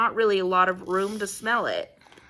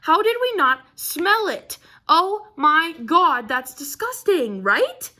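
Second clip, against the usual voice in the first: much higher, louder frantic rant; frantic disbelief; 8 semitones higher, 6 dB louder than usual. Retold or imitated. Couple realizing the cat hid poop, frantic high disbelief. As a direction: spiral into horrified questions. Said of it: "continue that sentence and go".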